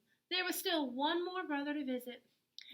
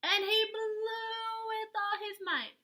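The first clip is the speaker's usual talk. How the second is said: much higher, straining; dramatic blow; 11 semitones higher, 3 dB louder than usual. A narrator's reading. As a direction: push it up like a gale. Narrator acts the big blow.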